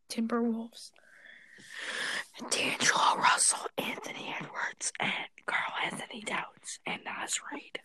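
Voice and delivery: soft unvoiced whisper. Real, not acted. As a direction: whisper the names. Whispered list of players.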